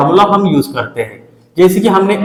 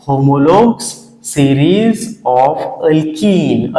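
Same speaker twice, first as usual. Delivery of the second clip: very slow dictation; much slower than usual. Shown as a demonstration. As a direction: stretch series of alkene out very slowly. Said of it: "repeating the term for notes". (नया शब्द बहुत धीरे खींचकर)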